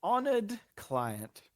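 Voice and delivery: slow, high, pompous reading; mock-grand address to a client; grand letter reading. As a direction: read it like a stately formal letter. Reads scam email pompously.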